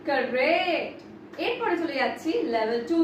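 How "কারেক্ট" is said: ordinary talk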